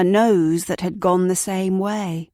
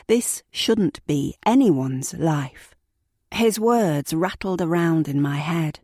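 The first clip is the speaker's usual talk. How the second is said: ordinary delivery, nothing marked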